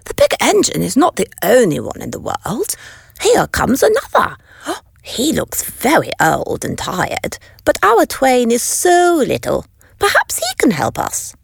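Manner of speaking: high, quick clown chatter; hopeful little clown; lightly voiced little clown. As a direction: clown spotting another engine, lightly high. Clown voice held.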